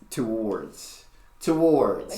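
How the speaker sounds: drawn-out "Towards" as too-WARDS; imitated pronunciation. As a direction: try out "Towards" with a long oo. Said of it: "but she's saying".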